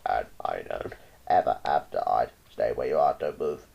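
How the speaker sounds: very low toad voice; low magic toad commanding; toad cries out. A narrator's reading. Toad orders the child to stay, very low. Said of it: "he cried".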